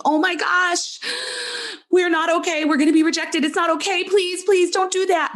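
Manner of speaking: put-on the anxious twelve-year-old inside begging not to be rejected; scared younger self. Inner child pleading with her adult self, high and scared. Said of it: "I feel this younger part of me saying".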